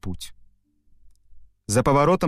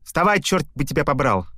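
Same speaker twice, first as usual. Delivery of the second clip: higher-pitched, exasperated order; angry; 5 semitones higher than usual. A narrator's reading. Iliko loses patience with the stubborn cow, angry. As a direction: curse it in mild anger. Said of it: "рассердился Илико".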